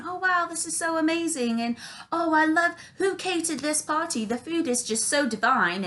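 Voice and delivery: high, gushing socialite voice; social butterfly. Retold or imitated. Mom charming everyone at the party, gushing socialite. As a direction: gush with put-on delight. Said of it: "be a social butterfly".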